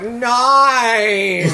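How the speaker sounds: mock Australian "no". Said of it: "You people are like".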